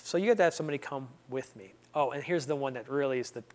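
ordinary delivery

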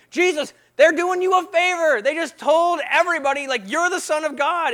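loud, high-pitched pleading objection; exasperated protest. Acted. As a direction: argue with Jesus in acted disbelief. Acts puzzled reader protesting to Jesus.

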